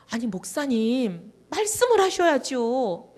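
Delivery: a slow frustrated plea to the pastor, climbing up; frustrated pleading. Retold or imitated. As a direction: press the pastor, exasperated. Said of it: "제가 너무 답답해서".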